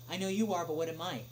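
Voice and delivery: ordinary talk, nothing marked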